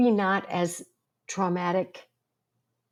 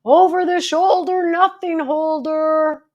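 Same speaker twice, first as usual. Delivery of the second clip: high, loud, sing-song jeer; mocking schoolboy taunt; 11 semitones higher, 8 dB louder, slower than usual. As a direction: taunt her like the boys on the street. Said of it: "and they'd be like".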